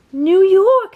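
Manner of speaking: high, loud joyful shout; excited exclaim. A narrator's reading. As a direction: cheer at the sight. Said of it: "they exclaimed".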